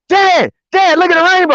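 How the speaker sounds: put-on high-pitched excited boy calling Dad; imitating flipped-out kid. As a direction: mimic a son bursting with excitement. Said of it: "we were flipping out".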